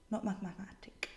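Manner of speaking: breathy whispered aside, MATHEMATIC stressed; whispered correction. Real, not acted. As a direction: mathematic whispered with a sly hint.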